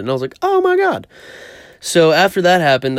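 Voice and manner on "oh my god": very high-pitched mock-shocked cry; retold dismay. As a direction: react to bad news in a squeal. Retold reaction to bad news.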